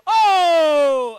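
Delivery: loud crowd-pumping yell; hype shout. Real, not acted. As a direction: pump up the room.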